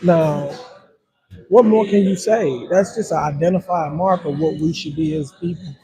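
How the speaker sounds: normal talking voice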